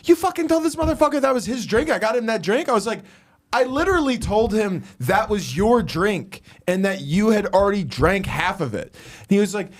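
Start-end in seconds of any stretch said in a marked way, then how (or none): 0.0-2.7 rapid, high-pitched mock tirade; hammed-up indignant rant, very high; Tommy. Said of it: "angrily looks at me". 3.5-8.9 high-pitched, insistent self-defence; retold indignant protest; mock exasperation. Retold argument, defending himself.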